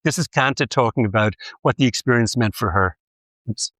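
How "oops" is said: normal talking voice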